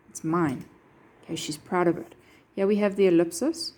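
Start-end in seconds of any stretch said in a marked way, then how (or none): none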